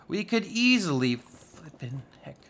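ordinary delivery

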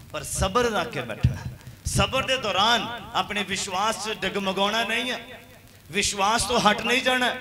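preaching at full force; fervent exhortation. Acted. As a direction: urge the church not to give up. Exhorting congregation.

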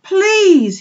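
very high, loud appeal; pleading cry. A narrator's reading. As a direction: cry out to halt the flock. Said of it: "he cried".

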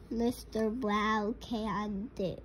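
usual speaking voice

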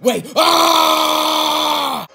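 high word into long loud scream; alarmed scream. Acted. Knight suddenly swept away mid-attack, screaming. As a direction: break off into a sustained scream.

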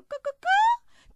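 loud, very high squawked cluck; clucking hen. Acted. Mouse reaches the hen in the fable, clucking. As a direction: cluck like a hen, drawn out and piercing.

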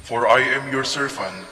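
ordinary talk

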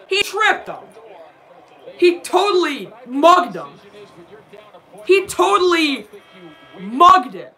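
loud, high protest; angry yelling. Real, not acted. Streamer still yelling at the TV over the foul, angry. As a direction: keep shouting at the officials. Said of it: "I'm sorry for yelling".

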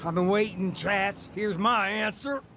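angry collector shouting; menacing shout. Acted. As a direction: shout at him to pay up. Ace threatening debtor.

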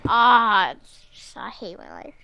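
defeated grumble. Real, not acted. Defeated after losing in game.

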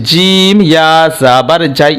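slow chant with "জিম" stretched long; drawn-out "জিম" in a drill chant; elongated spelling. Shown as a demonstration. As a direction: hold the vowel of "জিম", then spell on slowly. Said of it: "টান দিয়ে বলেছি".

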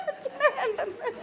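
high, soft words through giggles; laughing. Real, not acted. Adult repeating that child doesn't want father, laughing.

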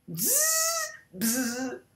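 high then quiet buzzing fly. Pretend. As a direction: buzz like a fly. Imitates a fly.

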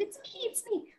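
high, soft squeak; timid little voice. Acted. Firefly owning up to the woken giraffe, timid little voice. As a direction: pipe up softly in a tiny high voice.

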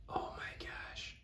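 slow, very soft whisper of amazement; awed whisper. Real, not acted. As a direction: whisper it slowly, stunned. Same reaction, now in awe.

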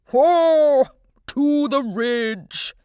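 loud booming bellow; proclaiming walrus. A narrator's reading. Walrus proclaiming to the pup, loud bellow. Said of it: "he proclaimed".

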